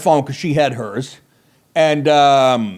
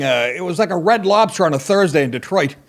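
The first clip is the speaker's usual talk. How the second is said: ordinary delivery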